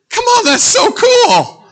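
high, slow, loud squeal of delight. Real, not acted. Father thrilled by his own baby name idea, squealing.

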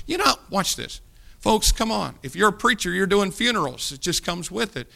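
ordinary delivery

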